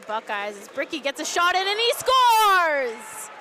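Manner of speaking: building to an excited goal shout; rapid hockey play-by-play. Real, not acted. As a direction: call the goal, stretch the name. Live play-by-play goal call.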